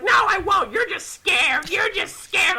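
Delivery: high-pitched mocking imitation; taunting whine. [name] taunting Ethan at the back, whiny. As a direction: mimic a friend's needling denial.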